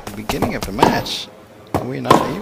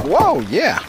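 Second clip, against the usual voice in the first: high, delighted whoop; much higher, thrilled reaction; excited cheer; 14 semitones higher, 4 dB louder than usual. Real, not acted. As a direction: exclaim with sudden excitement. Delighted by a rally shot.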